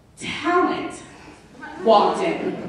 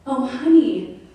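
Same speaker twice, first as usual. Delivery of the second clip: mock concern; 5 semitones higher than usual. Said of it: "But what she got was".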